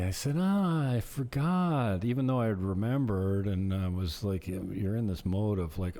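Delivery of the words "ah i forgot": high, drawn-out mock realization; feigned forgetfulness. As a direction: stretch it out as if just remembering. Acting out pretended forgetting.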